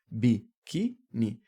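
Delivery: "biquíni" very slowly in separate syllables; separação silábica. Shown as a demonstration. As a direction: spell out "biquíni" as bi, qui, ni. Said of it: "Separar a sílaba". ("biquíni" pausado, sílaba por sílaba)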